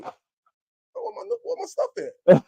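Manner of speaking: high, startled where's-my-stuff cry; indignant surprise. Retold or imitated. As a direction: act out finding the food gone, voice jumping up. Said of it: "I'm like".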